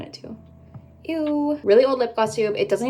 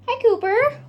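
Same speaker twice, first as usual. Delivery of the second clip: high, sing-song call to the cat; cat greeting; 13 semitones higher, 3 dB louder than usual. Real, not acted. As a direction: coo up at the pet. Greeting her cat on stairs.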